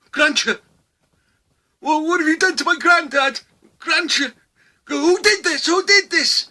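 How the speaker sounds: high, loud, accusing grandson voice; angry grandson. Acted. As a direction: demand answers like an outraged grandson.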